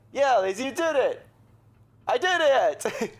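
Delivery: loud, gleeful cheering; excited cheer. Acted. Teen cheering after the sailor finally answers, loud and gleeful. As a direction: shout it out like you just won.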